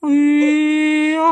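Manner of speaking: long, high, stretched-out ayyo of sympathy; mock-pitying drawn-out oh no; drawn-out sympathetic ayyo. Real, not acted. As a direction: pull the ayyo out slowly, sounding sorry for her. Parent soothing crying toddler.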